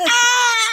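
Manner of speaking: high loud silent-cry wail; strangled cry. Retold or imitated. Showing a soundless cry, a strangled wail. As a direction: squeeze out a strangled sob. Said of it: "'cause you're just like".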